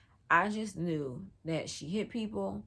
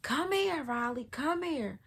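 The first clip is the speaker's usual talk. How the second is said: aunties calling out; 9 semitones higher, slower than usual. Retold or imitated. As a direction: call her over like a neighborhood auntie. Aunties calling her over.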